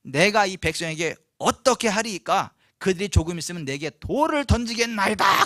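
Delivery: loud, desperate plea to God; crying out. Acted. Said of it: "여호와께 대해서 부르짖었어요".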